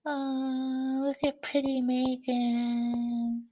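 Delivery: soft, slow, high coo; affectionate cooing. Real, not acted. Host admiring Megan on the stream, affectionate cooing. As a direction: coo it softly and stretch it out.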